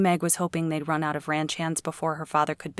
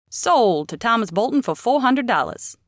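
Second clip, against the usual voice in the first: higher, loud sale announcement; auctioneer announcing sale; 6 semitones higher, 6 dB louder than usual. Acted. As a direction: proclaim the sale.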